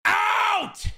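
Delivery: one very high, sudden shout; joke shout-out. Pretend. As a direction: yell the word at full pitch for a gag. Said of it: "can you do a shout out".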